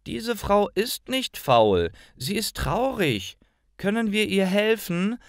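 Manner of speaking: a hint of a child begging grandma to help; pleading child. A narrator's reading. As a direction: plead for the woman like a young girl.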